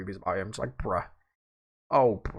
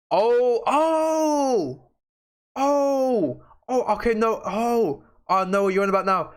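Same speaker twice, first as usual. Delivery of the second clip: sudden realization; 10 semitones higher, slower than usual. Real, not acted. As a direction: let it dawn on you all at once.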